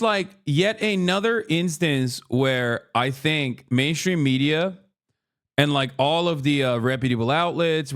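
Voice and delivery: ordinary talk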